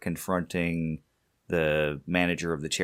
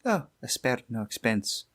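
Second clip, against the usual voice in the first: put-on 1 Scottish accent; 4 semitones higher than usual. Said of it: "With that Scottish accent".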